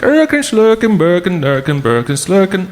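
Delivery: high sing-song mock Swedish gibberish; Swedish Chef voice. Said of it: "That's the Swedish chef".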